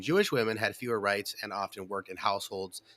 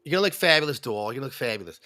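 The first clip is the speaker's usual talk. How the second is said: Woody Allen impression; 4 dB louder, faster than usual. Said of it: "my Woody Allen impersonation".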